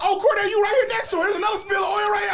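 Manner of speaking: very high, loud, rapid teasing shout; shouted taunt. Acted. Cousin turning oil spill joke on boy, shouted taunt. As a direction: crow it fast at full pitch.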